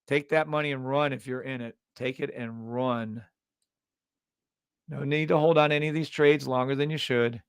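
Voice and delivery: ordinary talk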